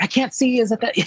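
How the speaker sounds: high, eager straining to see; peering hard from the suite, voice up high; retelling straining to spot the helmet; excited squinting. Retold or imitated. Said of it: "So I'm like".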